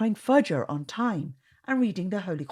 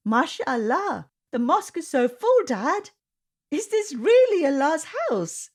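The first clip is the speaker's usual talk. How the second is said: put-on higher, louder wide-eyed boy; excited; 11 semitones higher, 4 dB louder than usual. A narrator's reading. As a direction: gasp in awe at the full mosque. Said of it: "asked Zaid excitedly".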